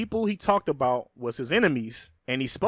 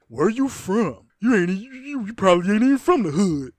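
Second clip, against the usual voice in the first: mocking commenter; 4 semitones higher, 3 dB louder than usual.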